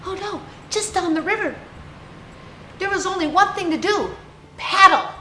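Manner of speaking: rising panic, climaxing on "paddle"; alarmed excitement. A narrator's reading. Storyteller telling children the bears near a waterfall, in high, fast alarm.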